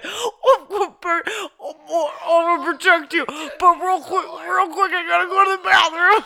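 high, loud, strained mock voice; straining on the toilet. Cohost mocking the sheriff, straining as if on the toilet. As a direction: push the words out as if holding it in. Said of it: "You sound like you're taking a shit".

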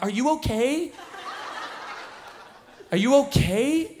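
high-pitched mock concern; antagonistic. Driver taunting a raging trucker through his window, antagonistic mock concern. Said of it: "I'm a little antagonistic".

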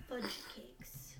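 fudge cakes whispered under the breath; a hushed, annoyed little oath; whispered curse. Real, not acted. Muttering at her own mistake, whispered.

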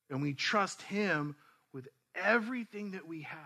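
slow, deliberate sermon delivery; preaching. Real, not acted. Pastor to the church, slow and firm. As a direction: lay each phrase down firmly for the congregation.

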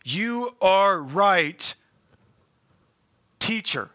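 You are right, teacher drawn out slowly with long pauses; measured, deliberate quote of the scribe; slow quoted reply. Retold or imitated. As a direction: let each word of You are right, teacher hang. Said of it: "notice what he says".

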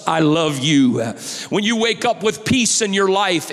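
loud, driving preacher build; full-force preaching. Acted. Pastor preaching love to the congregation, full force. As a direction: preach it out to the whole church.

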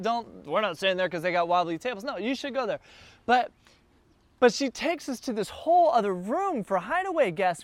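usual speaking voice

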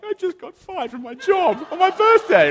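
a hammed-up wail of a fired boy; upset teen. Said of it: "And he's like".

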